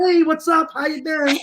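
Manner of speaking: lightly mimicked actor greeting; casual greeting. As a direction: play it off like nothing happened. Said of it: "be like".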